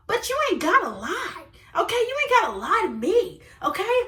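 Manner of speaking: high, singsong scolding; sassy acted reproach; indignant telling-off. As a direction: call out the lie, pitch swooping up.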